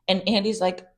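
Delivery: ordinary delivery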